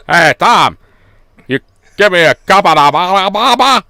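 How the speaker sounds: hammed-up Belfast mumble trailing into babble; mock Northern Irish accent. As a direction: take off a mate's garbled Northern Irish. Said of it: "the Northern Irish one came out".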